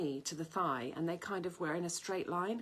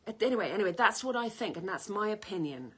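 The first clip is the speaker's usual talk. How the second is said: retold argument; 4 semitones higher, 7 dB louder than usual. Retold heated debate, her side.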